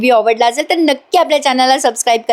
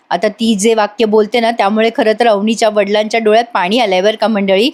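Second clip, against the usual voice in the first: ordinary delivery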